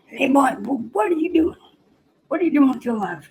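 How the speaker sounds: high, blustering cartoon petty officer; Foghorn Leghorn. Petty officer quizzing a recruit, high blustering cartoon voice. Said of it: "they do sound like Foghorn Leghorn".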